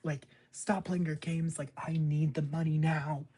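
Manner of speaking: high, loud, frantic mock rant; imitating a furious outburst. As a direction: ham up an actress losing it. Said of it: "lose her shit".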